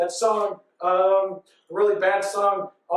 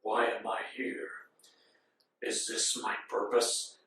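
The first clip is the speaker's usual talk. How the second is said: brooding Mewtwo; 10 semitones lower, 11 dB quieter than usual. Retold or imitated. Retelling Mewtwo waking up to life, brooding whisper. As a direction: whisper it low and ponderously. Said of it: "So then he's like".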